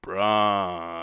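flat, lifeless, zero energy; listless. Pretend. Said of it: "most listless impression ... no life whatsoever ... as poorly as possible".

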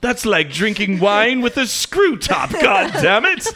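slow, high shouted protest; hammed-up indignant holler; mock outrage. Scolding a friend who mutes porn, mock-outraged shout.

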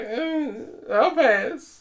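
very high, pixie-like hillbilly; high-pitched comic hillbilly impression; funny hillbilly voice. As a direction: imitate an odd hillbilly in a tiny high voice. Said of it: "He spoke with this funny hillbilly voice".

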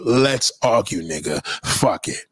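usual speaking voice

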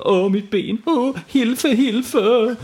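high, whimpering moans for help; moaning.

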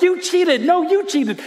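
put-on high, loud kids' accusations; mock squabbling. Dad imitating his kids fighting over checkers, mock squabbling. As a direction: imitate two bickering kids, pitched right up. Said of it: "they're, like, at each other's throats".

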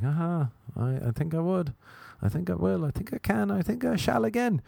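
playful rising staircase of pitch; mocking comeback. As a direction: pitch climbing phrase by phrase. Acts mocking comeback, climbing pitch.

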